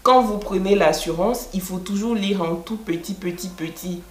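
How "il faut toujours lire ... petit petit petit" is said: fading to a murmur. Retold or imitated. Telling viewers to read the fine print, fading murmur. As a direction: voice shrinks with each petit.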